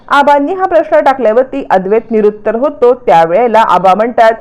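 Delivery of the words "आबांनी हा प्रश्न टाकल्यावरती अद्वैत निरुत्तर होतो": ordinary delivery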